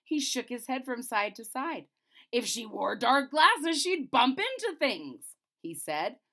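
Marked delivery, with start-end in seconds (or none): 2.3-5.3 slow, loud, angry king. A narrator's reading. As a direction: scold the chamberlain, slow and loud. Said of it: "the king very angry".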